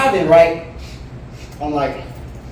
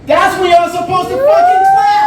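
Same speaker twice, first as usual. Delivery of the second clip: loud, high shout at the crowd; mock demand; 7 dB louder than usual. Real, not acted. Comic yelling at the crowd to clap for him. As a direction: bark it at the audience for applause. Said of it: "demanding applause from audience".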